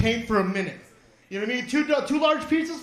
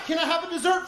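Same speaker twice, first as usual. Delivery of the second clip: normal talking voice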